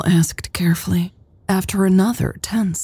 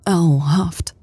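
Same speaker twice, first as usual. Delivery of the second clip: ordinary delivery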